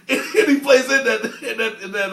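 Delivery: real laughter; laughing. Real, not acted. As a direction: speak through laughter. Laughs mid-sentence.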